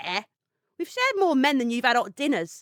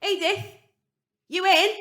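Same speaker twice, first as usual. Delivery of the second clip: calling out; 6 semitones higher, 4 dB louder than usual. Acted. Calling through the door.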